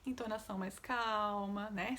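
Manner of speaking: ordinary talk, nothing marked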